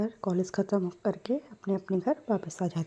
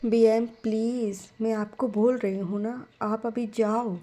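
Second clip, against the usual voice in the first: plain reading voice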